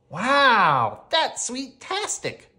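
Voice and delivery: big wow, then drawn-out high delight; acted kid-like glee; happy shriek. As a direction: shriek with joy, stretching it out. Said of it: "Peter and I shrieked with happiness".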